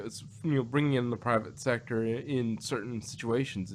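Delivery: ordinary talk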